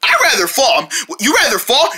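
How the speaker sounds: normal talking voice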